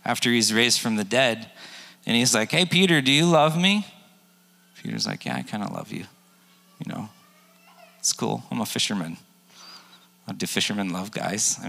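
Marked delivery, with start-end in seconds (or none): 2.5-3.9 Jesus calling Peter. Retold or imitated. Jesus voiced in retold story. 5.3-9.2 offhand Peter. Retold or imitated. Peter voiced shrugging.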